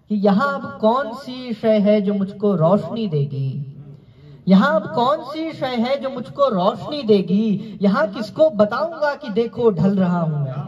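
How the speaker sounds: lifted, lilting mushaira verse; poetry recitation. Acted. Recites couplet.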